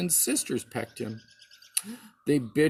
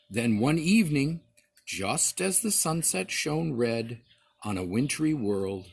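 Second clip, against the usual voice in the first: usual speaking voice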